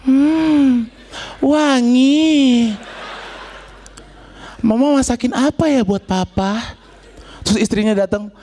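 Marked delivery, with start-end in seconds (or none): 0.1-6.8 ad husband voice. Retold or imitated. Acting husband in food ad.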